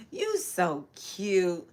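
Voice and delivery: slow, cooing you so cute; tender, drawn-out. Real, not acted. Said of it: "repeats it slowly".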